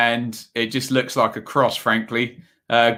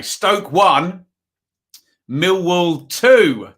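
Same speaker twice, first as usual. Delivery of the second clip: higher, louder call of the score, with Millwall two slowed right down; score announcement; 5 semitones higher, 3 dB louder, much slower than usual. Real, not acted. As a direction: announce the score like a stadium caller. Announcing a live score update.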